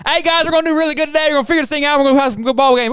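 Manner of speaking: high, fast, chirpy pep talk; mock coach, relentlessly upbeat; overly cheery coach. Mimicking the coach cheering the team, high and fast. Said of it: "too happy all the time".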